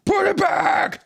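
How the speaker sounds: very high hammed-up yell; mock panicked shout. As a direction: scream the order in fake panic. Voices authorities panicking over iPhones.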